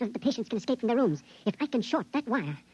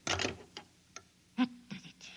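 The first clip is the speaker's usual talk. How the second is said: a slow effortful whisper under his breath; strained whisper; 5 dB quieter, much slower than usual. Acted. Prisoner straining at the cage lock, whispering. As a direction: whisper it while forcing something loose.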